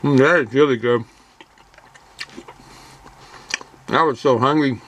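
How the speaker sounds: talking through a mouthful of sub; mid-chew, words round food; chewing while talking. Real, not acted. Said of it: "Excuse my talking while I'm eating".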